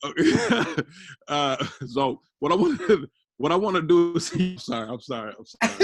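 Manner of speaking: apologizing through giggles; can't keep a straight face; laughing. Real, not acted. Laughing, can't continue roleplay.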